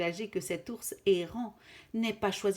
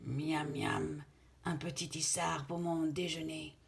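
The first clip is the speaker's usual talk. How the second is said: lynx voice; 3 semitones lower than usual. Acted. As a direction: savour it like a hungry cat. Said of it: "dit l'étrange animal".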